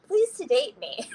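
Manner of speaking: high-pitched mock plea; retold plea. Stroke patient begging doctors for sedation, high mock plea. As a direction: plead lightly, voice jumping way up.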